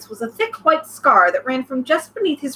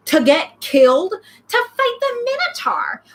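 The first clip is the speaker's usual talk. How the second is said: indignant; 9 semitones higher, slower than usual. Acted.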